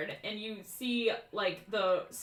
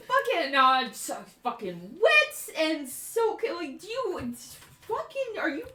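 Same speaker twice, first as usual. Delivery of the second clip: high-pitched, sputtering outrage; hammed-up indignant whine rising to a cry; exasperated rant; 11 semitones higher, 7 dB louder than usual. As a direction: rail at them, flustered and fed up. Soaked character rants at the party.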